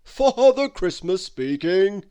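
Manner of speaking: put-on slow, loud hearty old man; Father Christmas. Father Christmas answering the long-distance call, slow hearty voice. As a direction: announce yourself grandly and slowly.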